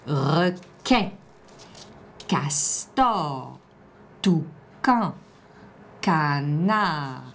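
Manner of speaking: slow, separated syllables; careful word dictation. Shown as a demonstration. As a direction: split each word so the sounds stand out. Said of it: "t'écoutes bien pour trouver quel son".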